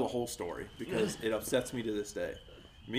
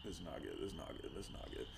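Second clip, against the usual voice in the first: fast, much softer, fading to a whisper; panicked whisper; 9 semitones lower, 19 dB quieter, much faster than usual. Retold or imitated. Retelling the car ride, whispering in panic. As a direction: mutter the panic under your breath. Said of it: "I was like".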